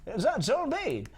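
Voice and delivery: incredulous. Real, not acted. Amused high disbelieving query.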